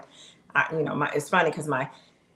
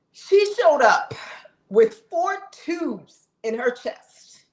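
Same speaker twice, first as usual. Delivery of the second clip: emotional emphasis; 7 semitones higher, 5 dB louder, much slower than usual. Real, not acted. Moved recalling friend's sacrifice.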